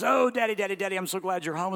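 put-on high, fast, eager child's voice; a four-year-old thrilled that Dad is home; excited little boy. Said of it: "and says".

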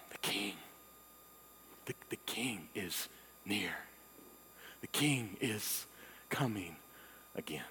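soft, low, wonder-struck; awed near-whisper; hushed awe. Acted. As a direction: breathe it like a secret discovery. Awed discovery of the king.

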